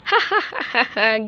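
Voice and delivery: gloating cackle; spiteful laugh; mean laugh. Acted. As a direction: laugh at her misfortune. Sister laughs at fallen girl.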